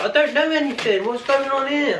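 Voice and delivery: high-pitched panicky retelling; panicked protest. Retold or imitated. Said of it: "shitting myself".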